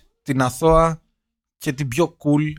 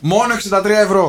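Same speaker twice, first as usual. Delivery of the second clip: higher-pitched hard-sell parody; mock telesales pitch; 5 semitones higher, 4 dB louder than usual. Co-host selling books by phone, mock sales pitch. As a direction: pretend to hawk books like a TV salesman.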